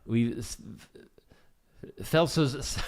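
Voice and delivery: put-on German accent. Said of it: "trying to do a German accent".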